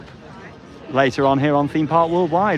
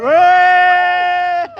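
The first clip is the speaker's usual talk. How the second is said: high, loud, drawn-out hooray; real whooping celebration; jubilant cheer; 13 semitones higher, 6 dB louder than usual. Real, not acted. Cheering with the livestream audience.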